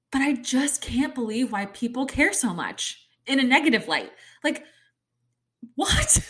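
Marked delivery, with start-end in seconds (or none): 5.7-6.3 high, loud disbelieving what; incredulous what. Real, not acted. Reacting to mermaid haters with a high incredulous yelp. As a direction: squeak it out in disbelief.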